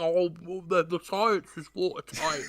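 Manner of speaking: talking through a mouthful; words round a bite of pizza; mouth full. Acted. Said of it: "through a mouthful of pizza".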